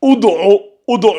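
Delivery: "ud'u" lu avec un ou initial; lecture avec ou initial. Shown as a demonstration. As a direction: prononce "ud'u" en commençant par le son ou. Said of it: "ça se lit ou". ("ud'u" articulé à l'arabe, ou au début)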